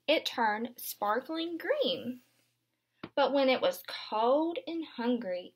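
gentle picture-book read-aloud; storybook narration for little ones; reading to children. Acted. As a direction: read it aloud to a class of young kids. Teacher reads picture book aloud.

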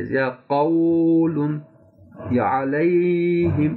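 कौलुन and अलैहिम with long drawn vowels; wrong, stretched. Shown as a demonstration. Teacher shows students the wrongly stretched readings. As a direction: drag the diphthongs in कौलुन and अलैहिम. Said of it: "लंबा करेंगे तो आपका ये पढ़ना गलत हो जाएगा".

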